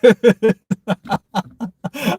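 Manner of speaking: long, high, helpless belly laugh; real uncontrollable laughing fit; hardcore laugh. Real, not acted. Streamer losing it at a chat comment, laughing hard. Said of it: "a hardcore laugh like that".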